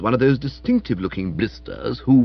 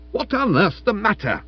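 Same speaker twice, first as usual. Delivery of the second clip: raised pitch, testy demand; 9 semitones higher than usual. A narrator's reading. Dyspeptic husband to his screaming wife, testy. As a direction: demand it with irritated impatience. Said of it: "he said testily".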